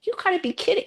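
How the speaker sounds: high, squeaky incredulous cry; can't-believe-it squeal; disbelief. Retold or imitated. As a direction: pitch it up in pure disbelief. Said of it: "And I'm like".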